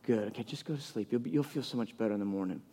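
very soft, low bedtime murmur; hushed and low, lulling a child to sleep; soothing dad. Retold or imitated. Dad sends daughter back to sleep.

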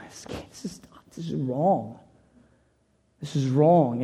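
whispered through tears, almost no voice; tearful whisper. Real, not acted. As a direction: barely get the words out, choked up. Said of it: "I'm crying".

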